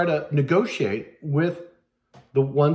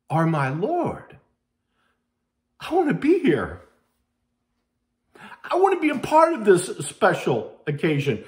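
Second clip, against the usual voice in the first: desperate pleading; 6 semitones higher than usual. Acted. Foolish virgins beg the bridegroom to let them in, high pleading. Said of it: "they're crying out".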